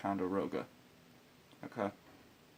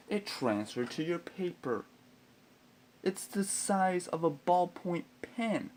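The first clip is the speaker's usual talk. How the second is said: outraged rant; 6 semitones higher than usual. Retold or imitated. Ranting about a dirt 'vandalism' charge.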